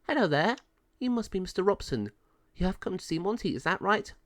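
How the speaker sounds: high, quick female officer voice; friendly pound officer. Acted. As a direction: greet a visitor in a high-pitched voice. Pound officer greets Ken.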